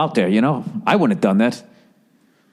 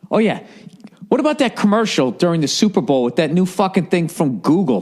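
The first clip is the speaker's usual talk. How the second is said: ordinary talk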